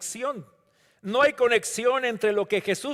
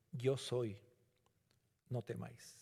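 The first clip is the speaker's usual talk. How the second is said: fading hush; 8 semitones lower, 15 dB quieter than usual. Acted. Third quiet repeat of words.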